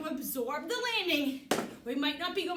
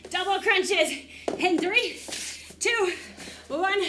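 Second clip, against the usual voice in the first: shouted countdown to the class; loud countdown; 5 semitones higher, 6 dB louder, slower than usual. Real, not acted. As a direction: call out the count loudly. Instructor calls out the countdown.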